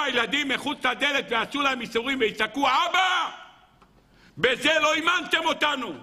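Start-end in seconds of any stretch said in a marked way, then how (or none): 2.7-3.3 put-on high shouted cry of a child; child's cry. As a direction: call out "אבא" like a terrified child. Children scream outside the door. (ילד זועק אבא)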